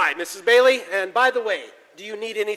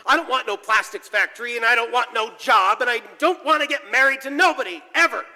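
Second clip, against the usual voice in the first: angry outburst; 4 semitones higher than usual. Acted. George shouts at Mary he won't marry, building higher. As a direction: build from anger to breaking.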